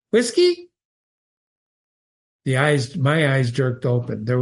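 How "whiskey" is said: much higher bright chirp on Whiskey, rising at the end; Johnny Bear chirps his request at the bar. Acted. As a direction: chirp "Whiskey?" up high like a begging bird.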